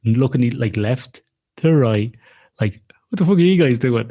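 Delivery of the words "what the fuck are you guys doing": high, louder puzzled seal voice; voicing a baffled seal staring at swimmers; seal's bemused look. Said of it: "he looks at us like a dog".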